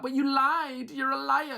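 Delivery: high-pitched indignant imitation; mock accusing. Contestants rounding on the man who confessed, mock accusing. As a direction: hurl the accusation in mock outrage.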